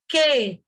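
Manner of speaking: "que" as "kê", no w; drawn "kê" with the u left out; silent u. Shown as a demonstration. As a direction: hold "que" with a plain k, skipping the u. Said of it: "O U é mudo".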